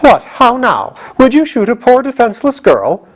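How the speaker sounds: high, mock-pleading reproach; reproachful. General Ginger reproaches the soldier, high pitched. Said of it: "said reproachfully".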